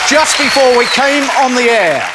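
fired-up host raising his voice; angry outburst. Real, not acted. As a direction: burst back in with real anger. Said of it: "I can't remember feeling this angry".

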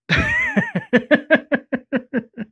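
breaks into a high laugh; bursting into laughter; real laugh. Real, not acted. Laughing at own retelling.

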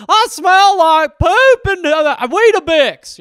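high, loud, slow goofy cartoon voice; dopey cartoon dog shouting out slowly. Goofy perfume mascot greeting people, high loud cartoon voice. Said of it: "It's like".

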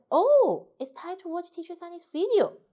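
bright burst of remembering; sudden realization. Shown as a demonstration. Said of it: "suddenly you realize".

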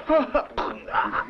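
high breathy forced laugh. Retold or imitated. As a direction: laugh on command.

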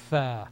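tha with tongue between the teeth; Arabic letter sound demo. Shown as a demonstration. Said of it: "Tongue between your upper and lower incisors".

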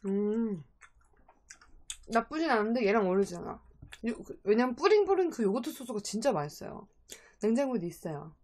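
talking with food in mouth; muffled chewing speech; chewing while talking. Real, not acted. Seen 3: Lips pressed, jaw working over food while holding chicken piece; dip unseen.

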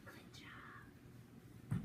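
whispered praise; a whispered good job. Shown as a demonstration. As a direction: breathe it, no voice. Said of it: "as quiet as we can".

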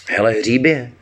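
high little creature voice; goblin voice. A narrator's reading. Forest goblin notices the dead foal, in a high voice. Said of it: "řekl skřítek".

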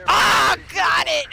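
loud, high burst of excitement; triumphant shout. Real, not acted. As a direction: yell it out in triumph. Triumph at a kill.

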